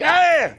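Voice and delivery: high mock scream of frustration; short, high exasperated cry; frustrated yelp. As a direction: let out a comic shriek. Frustration at frozen computer.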